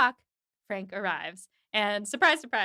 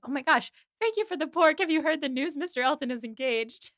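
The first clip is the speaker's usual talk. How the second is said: higher and louder, breathless chattering spinster; gushing Miss Bates; 5 semitones higher, 3 dB louder than usual. Retold or imitated. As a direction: gush out the news in one rush.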